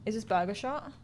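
normal talking voice

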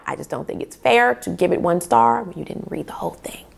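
soft unvoiced whisper; whispered confession. Real, not acted. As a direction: confide it under your breath. Confiding her review policy.

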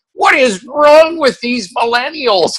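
high, slow, incredulous mock outburst; hammed-up disbelief, pitched high and slow; exasperated Tom. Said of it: "and he's like".

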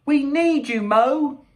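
high, urgent little voice; small creature begging for help; squeaky plea. Acted. Squeaky Percival pleads with Mo.

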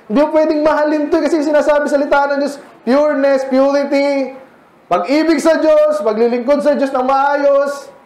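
high, forceful pulpit delivery; impassioned sermon at full force; fervent preaching. Real, not acted. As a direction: preach it hard, pitch lifted way up. Preaching at full force, answering question.

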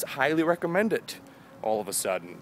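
ordinary talk

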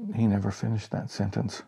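plain reading voice

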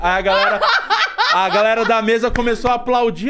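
laughs through the punchline, voice up high and loud; telling it while cracking up; laughing. Real, not acted. Comedian laughing as he tells friends how it ended.

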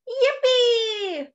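squeaky high, joyful yippee; small mouse bursting with glee; excited cheer. Acted. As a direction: jump for joy with a little mouse's cheer. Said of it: "Wow, he's really excited".

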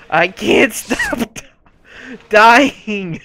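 real laugh cutting into the words. Real, not acted. Player keeps dying beside exasperated friend, laughing. Laughing at own repeated deaths.

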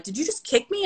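high-pitched baffled question; mock-incredulous high voice; imitated disbelief. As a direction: mimic the bully, unsure what hit him. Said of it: "He was just like".

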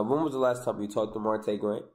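normal talking voice

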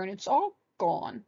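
lightly voiced sulky cub; a touch of childish sulk; sulking baby bear. A narrator's reading. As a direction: pout it like a small child. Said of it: "sulked Baby Bear".

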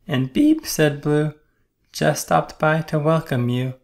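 high, bright-eyed little truck. A narrator's reading. Little Blue Truck asking the animals, high voice. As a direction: pitch it up like a small toy truck. Blue's voice.

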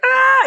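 very high, loud burst of emotion; sudden overwhelmed wail; cracking cry. Retold or imitated. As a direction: let it all break out. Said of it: "You're like".

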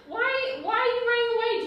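high, loud and rushed, begging him to stay; upset pleading. Real, not acted. Fiancée pleading with her partner not to walk out, high and urgent. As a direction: pitch jumps up in real alarm.

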